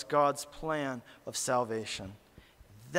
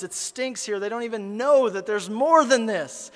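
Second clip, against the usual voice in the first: higher, rising preacher fervour, building in heat; impassioned preaching; 6 semitones higher, 4 dB louder than usual. Acted. Preacher to congregation, describing love for the maggots, fervently. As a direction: pour out growing compassion to the congregation.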